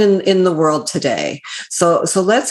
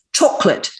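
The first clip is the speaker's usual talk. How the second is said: mock British chocolate; host tries British accent. Shown as a demonstration. Host shows off his one British word, in British accent. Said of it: "I can say pretty well one word in, in a British accent".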